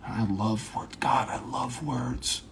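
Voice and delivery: soft, slow, intense confession; mock big talk. Confessing love of words, softly intense. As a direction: savor it quietly, with mock gravity. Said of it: "have small talk as if it's big talk".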